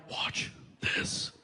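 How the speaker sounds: whispered aside; sly whispered aside. Retold or imitated. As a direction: tip off the audience secretly. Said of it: "I was like".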